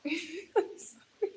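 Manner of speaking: high, soft, laughing sorry; giggling apology. Real, not acted. Streamer apologising to viewers after a slip, giggling.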